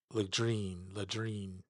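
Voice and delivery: "Ladrine" as "luh-DREEN"; attempting a hard name. Shown as a demonstration. Fan trying a hockey player's name, "Ladrine" as luh-DREEN. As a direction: say "Ladrine" with a weak first syllable and long "dreen". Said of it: "I never get it right".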